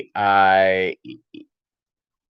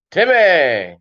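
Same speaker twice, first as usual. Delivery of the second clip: a light cartoon-kid Timmy shout, higher and louder; Timmy from South Park; 6 semitones higher, 4 dB louder than usual. Streamer recalling the South Park character, mock Timmy call. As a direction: blurt his one word like the South Park kid. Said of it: "Timmy's great rock band".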